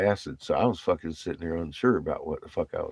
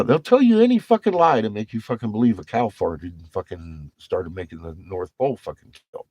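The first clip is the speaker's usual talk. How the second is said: usual speaking voice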